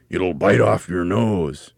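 slow, loud, drawn-out warning; ominous teasing. A narrator's reading. Mr. Twit describes the beast to frighten his wife, ominous teasing. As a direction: stretch it out menacingly.

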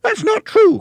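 shrieky high cry, very loud; Missus Twit. A narrator's reading. Wife demanding an explanation from her husband, high loud cry. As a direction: cry it out at the top of your range. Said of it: "cried Missus Twit".

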